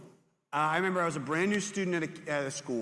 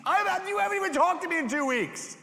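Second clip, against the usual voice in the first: much higher, exasperated; high indignant protest; 5 dB louder than usual. Retold or imitated. Youth pastor replaying his young self, high indignant squeal. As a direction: squeal out the complaint. Incredulous retold reply.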